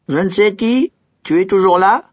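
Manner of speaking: haut et fort; appeler, un peu inquiet. A narrator's reading. L'idiot appelle. (l'idiot appelle le serviteur, voix haute)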